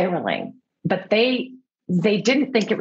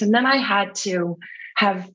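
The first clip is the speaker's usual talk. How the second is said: normal talking voice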